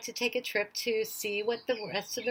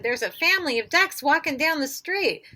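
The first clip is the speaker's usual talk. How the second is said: raised urgent report; 6 semitones higher, 5 dB louder than usual. A narrator's reading. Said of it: "yelled Michael".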